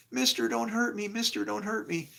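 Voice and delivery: high, scared begging; imitating the terrified kid; pleading kid. Kid begging in retold story.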